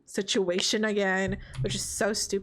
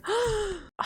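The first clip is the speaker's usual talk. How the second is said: sharp high gasp of shock; 10 semitones higher than usual. Retold or imitated. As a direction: gasp as if betrayed news just landed. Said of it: "And then Scarlett's like".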